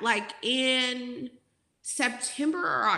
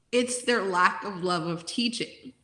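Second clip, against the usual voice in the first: ordinary talk, nothing marked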